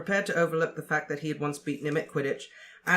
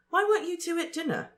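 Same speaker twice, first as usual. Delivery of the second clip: put-on high, brisk girl's voice; brisk Hermione. A narrator's reading. Hermione asking Harry and Ron about dinner, brisk and high.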